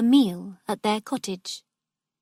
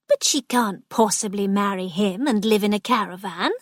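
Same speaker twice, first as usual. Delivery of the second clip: ordinary delivery, nothing marked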